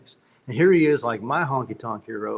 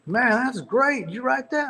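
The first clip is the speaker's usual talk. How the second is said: much higher, imitating an impressed Billy Joe; 12 semitones higher than usual. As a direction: play Billy Joe delighted by the song. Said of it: "And Billy Joe goes".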